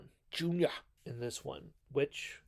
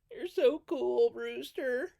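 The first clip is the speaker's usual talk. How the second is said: put-on much higher, louder gushing kid voice; starstruck kid; 12 semitones higher, 8 dB louder, slower than usual. The kid from Fright Night admiring Brewster, high gushing voice. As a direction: gush like an awestruck boy. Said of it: "the name of the kid in Fright Night".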